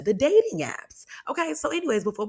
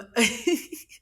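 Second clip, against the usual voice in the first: short awkward giggle; a little nervous giggle; 7 semitones higher, 4 dB louder than usual. Real, not acted. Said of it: "I don't know why I'm laughing".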